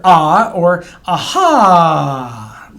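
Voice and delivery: aha drawn out slowly, short ah then long ah; teacher modelling Hebrew vowel. Shown as a demonstration. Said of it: "the long A and the short A".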